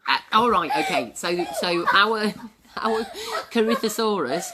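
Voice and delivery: normal talking voice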